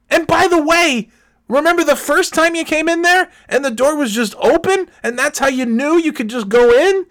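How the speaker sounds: high-pitched, loud comic tirade; outraged scolding. Retold or imitated. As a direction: lecture an intruder in escalating exasperation. Acted scolding of the repairman.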